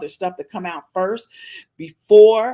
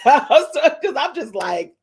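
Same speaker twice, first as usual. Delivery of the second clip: laughing excitedly; 5 semitones higher, 6 dB louder than usual. Real, not acted. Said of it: "I'm so excited".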